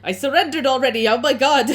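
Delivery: loud, high, rushed panic; frightened captive pleading for his life; shaking, panicked. Acted. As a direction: blurt it out in terror. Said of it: "like, sh-, shaking".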